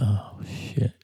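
soft whispered curse. Retold or imitated. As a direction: whisper it to yourself. Retold thought on waking.

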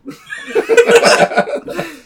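high, loud laugh breaking the reading; burst of laughter. Real, not acted. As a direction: crack up mid-verse. Laughs mid-poem at own line.

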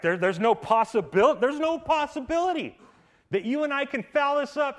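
high, loud, impassioned; preaching climax. Real, not acted. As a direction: lift it up with fervour. Preacher building to a peak.

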